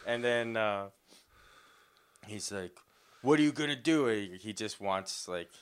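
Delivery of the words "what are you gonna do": higher, louder provoking challenge; mock taunt. Army guy taunting the man to react. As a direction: dare him to fight.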